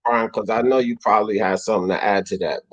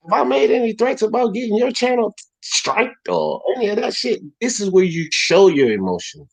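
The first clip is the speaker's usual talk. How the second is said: heated call-out; 6 semitones higher than usual. Real, not acted.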